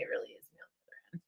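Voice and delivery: quick whisper. Real, not acted. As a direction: let them in on a secret. Confides that she answers messages.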